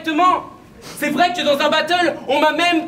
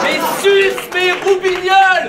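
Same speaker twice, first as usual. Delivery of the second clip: mock outrage; 4 semitones higher, 4 dB louder than usual. Mock outraged exclamation in battle.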